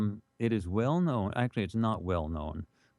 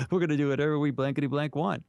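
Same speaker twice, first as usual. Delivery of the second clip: defiant taunt; 4 semitones higher, faster than usual. Retold or imitated. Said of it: "giving Napoleon the bird".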